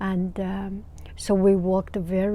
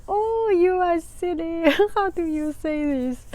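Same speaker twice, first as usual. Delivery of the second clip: put-on higher, child-like teasing; children teasing; 8 semitones higher than usual. First graders teasing their teacher, high voiced. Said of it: "And they say".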